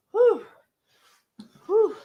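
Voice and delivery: high breathy whew from the heat; burning whew. Real, not acted. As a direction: blow out through a burning mouth. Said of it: "my throat is burning".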